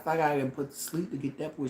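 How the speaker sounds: ordinary delivery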